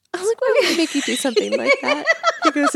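high, loud question through laughter; laughing. Real, not acted. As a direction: ask it helplessly amused, quickly. Laughing while retelling her question.

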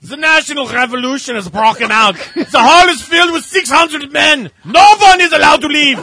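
hammed-up German accent. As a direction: bark it like a cartoon German dictator. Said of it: "read that entire thing in an accent".

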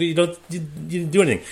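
stunned he wants paying; incredulous protest. Retold or imitated. To the translator who did nothing, high incredulous protest. Skipper protests unearned fee.

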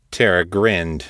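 ordinary delivery, nothing marked

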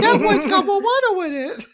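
put-on high, babyish whine; adult baby voice. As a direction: play a fussy adult baby complaining. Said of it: "the adult baby's like".

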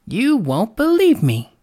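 high, slow lark voice; lightly voiced lark, pitched well up; teasing lark. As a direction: draw it out high, as a challenge.

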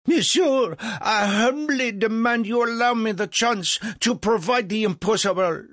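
high, loud, pompous demand; insistent French impresario. Acted. Legras demands Franklin allow him the impossible, high and insistent. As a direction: declaim it with wounded grandeur.

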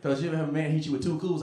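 usual speaking voice

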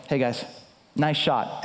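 low, deadpan casual remark; deflated understatement. Retold or imitated. Soaked pastor to two shaking seventh graders, low and deflated. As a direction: toss it off low and mild, anger gone. Retold anticlimax to shaking kids.

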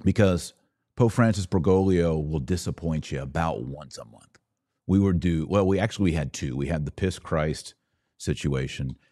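ordinary delivery

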